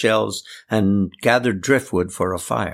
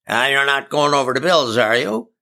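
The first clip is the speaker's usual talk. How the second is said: higher, lightly accusing question; mildly accusing; 5 semitones higher than usual. A narrator's reading. John questioning the girls about going to Bill's, mildly accusing. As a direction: challenge them with a touch of suspicion. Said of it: "John accused".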